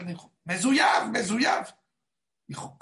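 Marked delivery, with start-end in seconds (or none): 0.4-1.7 high mock yell of the driver; retold yelling. Driver yelling at the passenger, a mock yell. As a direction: bark it like an angry bus driver. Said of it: "él empezó a gritar".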